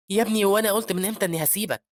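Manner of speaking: put-on loud, high protest to a child; shocked protest. Shocked man picks up the child who accused him, protesting. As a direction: protest like a wrongly blamed father. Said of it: "بيتصدم الراجل من اللي شافه".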